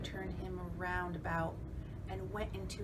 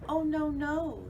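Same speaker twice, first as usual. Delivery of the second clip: fly's voice; 9 semitones higher, 8 dB louder than usual. Acted. Fly refuses the spider.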